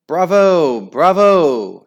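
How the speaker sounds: loud cheering bravo; shouted bravo. Acted. Opera club chorus cheering the singer, loud shouting. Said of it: "shouted a chorus from the sitting room".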